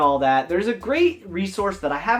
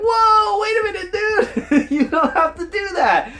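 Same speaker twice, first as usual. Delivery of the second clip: alarmed protest; 13 semitones higher, 4 dB louder than usual. Retold or imitated. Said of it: "my wife and I are kinda like".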